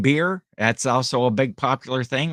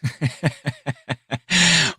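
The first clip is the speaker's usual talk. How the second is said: amused laugh; 3 semitones higher than usual. Real, not acted. Laughs before his joke voice.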